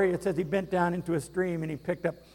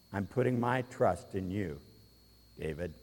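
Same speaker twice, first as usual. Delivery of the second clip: much softer, lower, solemn trust; King Saul quietly entrusting David; solemn hush; 7 semitones lower, 8 dB quieter than usual. Acted. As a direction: hand over the trust in a hushed voice. Saul voiced, hushed and solemn.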